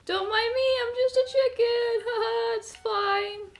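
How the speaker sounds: talking to the enemy as a pretend chicken; innocent chicken act. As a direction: play dumb like a harmless chicken.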